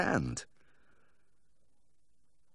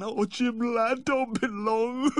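very high, wailing plea; distraught, drawn-out begging; tearful begging; much slower than usual. Acted. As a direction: implore through mock tears. Owner begs vet to come.

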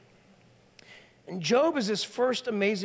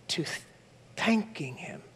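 breathy hushed aside; whisper; 5 semitones lower, 4 dB quieter than usual. Real, not acted. Pastor telling the congregation, dropping to a whisper. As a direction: let it fall to a whisper. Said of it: "unvoiced whisper".

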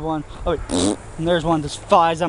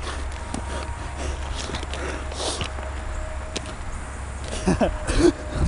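unvoiced laugh; 3 semitones higher, 10 dB quieter than usual. Real, not acted. Laughs after own joke.

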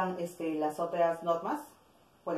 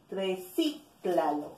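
Recíclalo drawn out slowly on CÍ; stress on antepenultimate syllable; much slower than usual. Shown as a demonstration. Teacher showing an esdrújula word, slow stress on CÍ in Recíclalo. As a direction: slowly split Recíclalo, leaning on CÍ. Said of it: "esdrújula".

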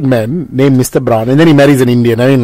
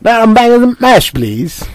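raised Scouser-style food order; northern English accent; 7 semitones higher, slower than usual. Shown as a demonstration. Showing friends how a northerner orders, northern English accent. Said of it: "it's either Scouser or Mancunian".

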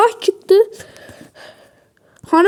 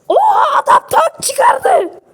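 higher, slow, stretched-out shout; shocked shout; 9 semitones higher than usual. Real, not acted. Stunned at the enemy player's tank, a long high shout. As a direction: wail it out in disbelief.

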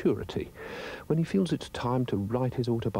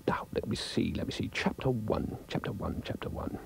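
soft, half-whispered musing; muttering to himself; 5 dB quieter than usual. Acted. Dog trying to start his first chapter, muttering. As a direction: murmur it under your breath while thinking.

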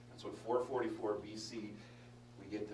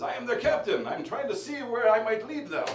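much higher, loud hammed-up reply; mock indignant shout; 12 semitones higher, 14 dB louder than usual. Captain answering whoever yelled at him, hammed-up high shout.

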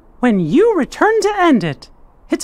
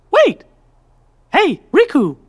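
sudden realisation; 3 semitones higher than usual. Acted. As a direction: cry out as it hits you. Sudden idea, calling out.

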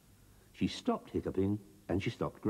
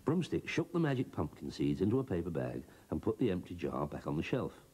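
ordinary delivery

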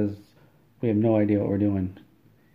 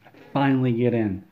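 ordinary delivery, nothing marked

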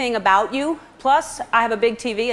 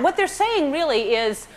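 ordinary talk, nothing marked

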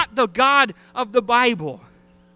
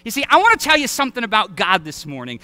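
ordinary talk

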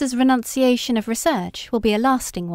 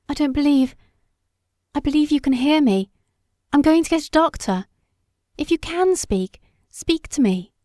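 high, urgent plea, voice climbing; frightened pleading; 6 semitones higher than usual. A narrator's reading. Wife pleading with her motionless husband, frightened and high. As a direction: beg him to answer, fear rising.

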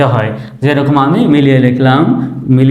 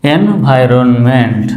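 Environment said slowly, syllable by syllable; teacher modelling environment, drawn out; slow model word; 2 semitones lower, much slower than usual. Shown as a demonstration. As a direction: sound out environment slowly for learners. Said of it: "এরপরে দেখেন".

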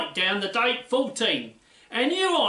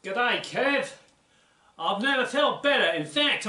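put-on light Australian accent, cheery; a hint of an Aussie bloke. [name] greets Kev on Fact Time, light Australian accent. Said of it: "I'm using a bit of Aussie slang".

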